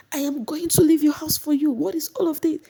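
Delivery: very high shrieking protest; mock her high defiant insistence; imitating an upset housemate; defiant Mercy. Mercy threatening Biggie.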